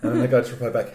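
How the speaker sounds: ordinary delivery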